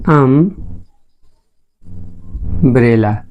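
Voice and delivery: Umbrella softly drawn out, अम... long pause... ब्रेला; slow sounded-out pronunciation. Shown as a demonstration. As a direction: sound out umbrella in two slow parts, quietly. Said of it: "umbrella pronunciate करें". (Umbrella को तोड़कर, धीमे और धीरे बोलें)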